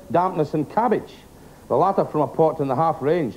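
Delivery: ordinary delivery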